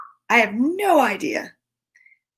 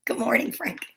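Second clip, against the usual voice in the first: breathy hushed greeting; whisper; 7 dB quieter than usual. Acted. Reader whispers a greeting to Frank in the book. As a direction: whisper it to the picture.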